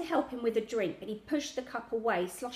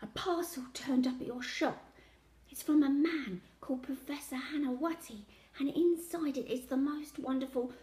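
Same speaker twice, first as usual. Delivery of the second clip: hushed, higher-pitched confiding voice; nervous whisper; 5 semitones higher than usual. A narrator's reading. Child telling grandad about a mysterious parcel, nervous whisper. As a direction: let out a secret nervously, half whispered. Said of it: "dropped my voice to a nervous whisper".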